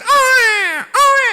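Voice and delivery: loud, high mock baby wail; imitated child's crying. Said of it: "Ada anak nangis".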